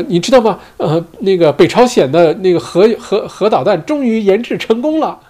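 hammed-up cheerful announcement; mock upbeat tone. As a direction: sell bad news as great news, pitched high. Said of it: "用一个非常积极向上的语气".

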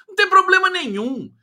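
high, loud, emphatic; excited insistence. Real, not acted. Host insisting to viewers, excited high insistence. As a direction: insist with a lift. Defending new flag idea.

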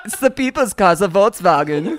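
put-on insistent German-accented correction; playful German; mock German accent. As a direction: lift the start in a comic German accent.

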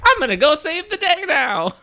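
high, bold superhero proclamation; heroic voice. Pretend. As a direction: announce it like a cartoon hero. Mock superhero quote.

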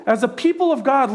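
ordinary talk

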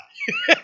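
short high laugh. Real, not acted. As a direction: burst out in a high, loud laugh. Laughs at own retold reaction.

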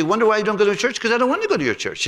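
high, fast, fed-up retort; the owner snapping back at the pastor; mimicking Bali. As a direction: rattle it off high and annoyed. Bali's retort retold.

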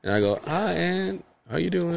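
soft, hushed greetings; quiet. Retold or imitated. Nephew and aunt exchanging quiet phone greetings. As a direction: say it softly. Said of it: "then she's like".

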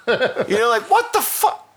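ordinary delivery

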